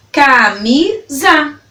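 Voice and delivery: CAMISA slowly split into ca - mi - za; three separated beats on CAMISA; syllable by syllable. Shown as a demonstration. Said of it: "falando elas pausadamente pra você".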